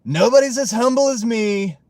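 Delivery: loud, high, hammed-up boasting; mock bragging. Streamer jokingly boasting to chat, loud and high. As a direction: brag it up as a joke, laughing. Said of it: "No, I'm just playing".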